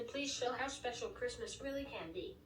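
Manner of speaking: usual speaking voice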